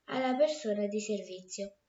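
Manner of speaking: plain reading voice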